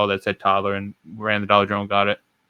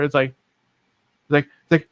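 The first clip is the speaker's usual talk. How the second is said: ordinary talk, nothing marked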